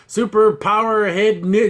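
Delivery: ordinary talk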